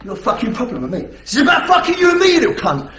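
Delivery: fast, building to a shout; aggressive threat. Shown as a demonstration. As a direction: snarl it out, then shout. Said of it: "he'll probably".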